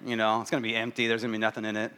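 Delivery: mild pessimist grumbling at a candy box; pessimistic grumble. Retold or imitated. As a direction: expect the worst and rush through it. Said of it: "if you're pessimistic".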